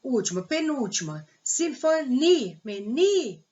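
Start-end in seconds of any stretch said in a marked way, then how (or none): 2.0-3.4 "ni, meni" with NI drawn out and punched; stressed syllable demo. Shown as a demonstration. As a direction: lean hard on NI in "meni". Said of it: "se a penúltima sílaba for mais forte".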